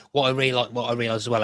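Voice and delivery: ordinary delivery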